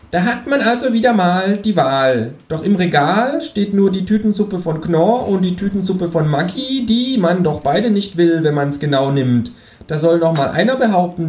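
hohe Känguru-Stimme trägt Gedicht vor; Känguru liest vor. Acted. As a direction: als Känguru das Gedicht vorlesen. (Känguru liest Gedicht mit hoher Stimme)

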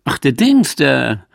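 high, loud exclamation easing off; acted burst of recognition; sudden recognition. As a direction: exclaim as the name dawns on you. Mock recognition in acted couple dialogue.

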